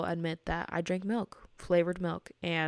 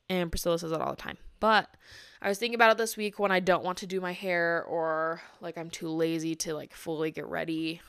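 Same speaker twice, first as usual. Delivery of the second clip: usual speaking voice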